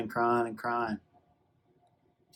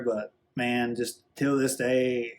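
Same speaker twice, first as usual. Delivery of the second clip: ordinary talk, nothing marked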